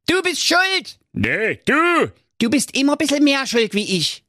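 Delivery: high, shrill bickering; squabbling villagers. A narrator's reading. Villagers blaming each other in high bickering voices. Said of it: "Sie riefen".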